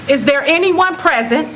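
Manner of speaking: usual speaking voice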